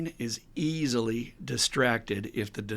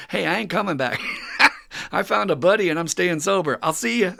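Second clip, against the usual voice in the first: higher, louder carefree voice; breezy Bill; 7 semitones higher, 7 dB louder than usual. Retold or imitated. Bill phones Lois to say he's staying, upbeat and louder. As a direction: toss it off, cheerfully leaving. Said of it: "he calls Lois up and says".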